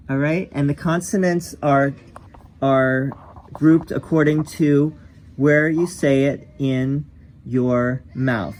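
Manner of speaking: ordinary talk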